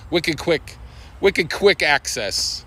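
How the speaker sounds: ordinary talk, nothing marked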